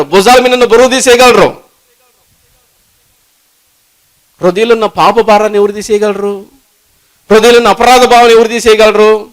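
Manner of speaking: loud, high, fervent preaching to the church; preaching at full force. Acted. Preacher urging the congregation, at full force. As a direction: preach it out with rising fire.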